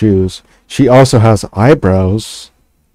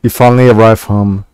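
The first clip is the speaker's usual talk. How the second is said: usual speaking voice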